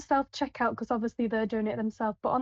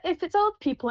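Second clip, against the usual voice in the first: ordinary talk, nothing marked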